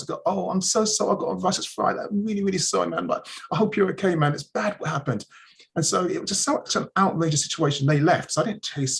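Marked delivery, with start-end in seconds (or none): 0.2-5.2 high-pitched, hurried apology; mock sympathetic brush-off, pitched up; apologetic bystander. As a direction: imitate a passer-by excusing themselves. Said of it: "women came up to me".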